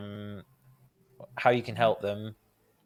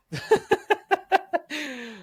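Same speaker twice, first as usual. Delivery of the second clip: gentle laugh. Real, not acted. Laughing at relatable family comment.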